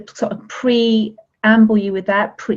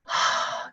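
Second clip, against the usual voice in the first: breathy release sigh; 8 dB quieter than usual. Shown as a demonstration. Letting head drop back.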